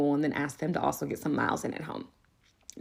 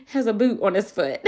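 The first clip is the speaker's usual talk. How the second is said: ordinary talk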